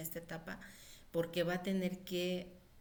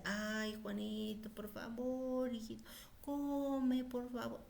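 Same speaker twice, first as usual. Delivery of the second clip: hesitant pleading mother; 5 semitones higher, slower than usual. Shown as a demonstration. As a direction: plead timidly, unsure of yourself. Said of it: "la mamá titubea y dice".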